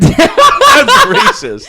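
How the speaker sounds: high, loud, slow drawn-out laughing cry; laughing mock outrage. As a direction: stretch it out in mock horror, laughing. Joking exaggerated accusation.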